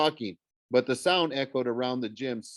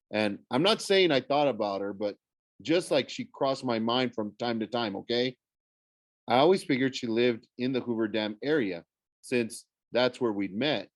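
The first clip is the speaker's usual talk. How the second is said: usual speaking voice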